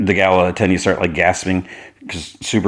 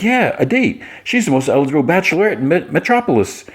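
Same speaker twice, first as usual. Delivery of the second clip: high excited quote; 9 semitones higher than usual. Retold or imitated. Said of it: "He's like".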